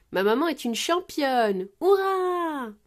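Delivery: loud, high, drawn-out cheering; proud cheer. A narrator's reading. Peppa cheering her mother, proud cry. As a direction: build to a high, long hurrah. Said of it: "s'écrie Peppa".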